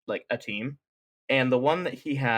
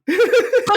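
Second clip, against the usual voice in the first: loud squeal of laughter; high sudden laugh; 10 dB louder than usual. Real, not acted. Podcaster caught out by her cohost, squealing high laugh.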